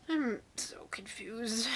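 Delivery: slow, soft, breathy deadpan; whispered confusion. Real, not acted. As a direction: sigh it out, baffled. Deadpan exasperation after reading.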